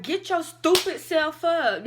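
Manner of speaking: scolding yell. Retold or imitated. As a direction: bark at yourself to get up. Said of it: "my brain's all like".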